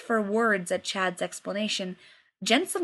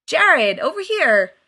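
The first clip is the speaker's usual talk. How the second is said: loud, high call across the room; hollering across the party for Jared; 6 semitones higher, 9 dB louder, slower than usual. Acted. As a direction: shout him over with a maniacal grin.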